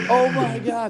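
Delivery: high, laughing dismay; laughing oh my God. Retold or imitated. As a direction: laugh it out in panic. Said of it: "And I was like".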